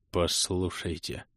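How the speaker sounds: slow, low, faint murmur; barely moving lips. A narrator's reading. Stunned crusader barely managing to speak, faint slow murmur. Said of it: "Едва шевельнул губами крестоносец".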